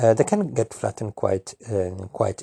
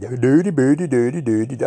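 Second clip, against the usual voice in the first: mock Swedish gibberish; 3 semitones higher, 4 dB louder than usual. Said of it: "speak like this".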